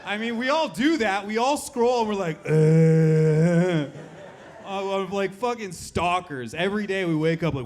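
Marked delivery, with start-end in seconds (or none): 2.4-3.9 loud, held mindless "uh"; long, mock-vacant "uhhh"; drawn-out scrolling noise. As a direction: imitate a zoned-out scroller with one long loud drone. Imitates people scrolling on phones.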